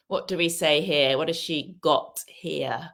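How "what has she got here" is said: ordinary talk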